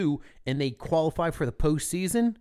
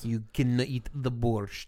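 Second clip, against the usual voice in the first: slow, lower hammed-up Russian accent; comic mock-Russian accent, drawn out; bad Russian accent; 5 semitones lower, 4 dB quieter, slower than usual. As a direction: drag it out in fake Russian. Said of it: "worst accent in the world".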